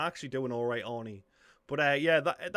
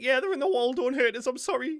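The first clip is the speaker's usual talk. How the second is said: higher and louder, hammed-up begging; mock panicked plea; 9 semitones higher, 5 dB louder than usual. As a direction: blurt it out like a caught coward. Imagined self caught, pleading.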